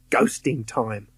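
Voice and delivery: usual speaking voice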